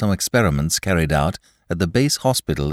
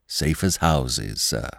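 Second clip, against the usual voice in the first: soothing; 5 semitones lower, 4 dB quieter, slower than usual. A narrator's reading. Servant soothing master.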